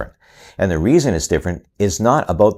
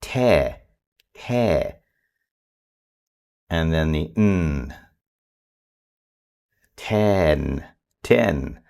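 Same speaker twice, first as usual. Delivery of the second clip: soft, drawn-out blending of te into ten; slow soft blending; 3 semitones lower, 4 dB quieter, much slower than usual. Shown as a demonstration. Parent blending sounds for a child, soft and slow. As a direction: stretch each sound gently. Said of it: "Blend those two together".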